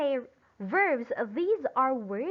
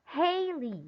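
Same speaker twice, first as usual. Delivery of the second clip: Haley with HAY higher and longer; stress on first syllable; 4 semitones higher than usual. Shown as a demonstration. Teacher answering how to pronounce this name, first syllable stressed in Haley. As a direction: hold the first syllable of Haley, let LEE fall. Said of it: "louder and longer than Lee".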